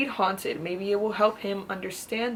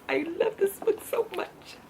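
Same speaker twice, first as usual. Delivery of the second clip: much higher, gushing squeal; high excited fangirl gush; 11 semitones higher than usual. Real, not acted. Gushing over favorite book.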